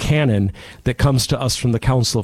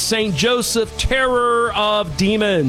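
raised, solemn blessing voice; priestly blessing; 6 semitones higher than usual. Acted. Priest blesses the radio audience to close the show, solemn intoned blessing. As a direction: bless the listeners like from the altar, intoned.

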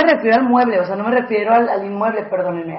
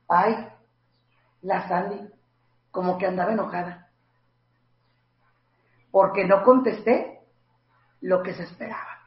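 softer, lower gossip voice; gossiping murmur; 3 semitones lower, 6 dB quieter than usual. Retold or imitated. People gossiping that Sandy seemed angry, softly. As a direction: murmur it like gossip behind her back.

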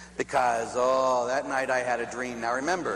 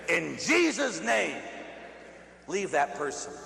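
stretched, raised opening, then a pause; acted solemn exorcism; exorcist's command. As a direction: proclaim it grandly, then drop to an order.

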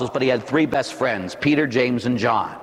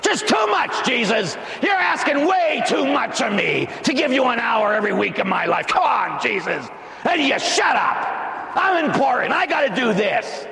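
parodying a churchgoer brushing off Jesus; mock whiny churchgoer; 8 semitones higher than usual. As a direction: sneer like someone too busy for mass. Mocking a reluctant churchgoer.

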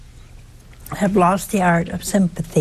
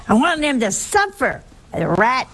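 mock indignation in a raised pitch; retold anger; 3 semitones higher than usual. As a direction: imitate a mad wife, mildly. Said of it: "She was so mad".

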